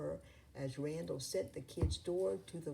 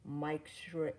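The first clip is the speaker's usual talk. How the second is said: ordinary delivery, nothing marked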